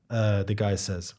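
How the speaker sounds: ordinary talk, nothing marked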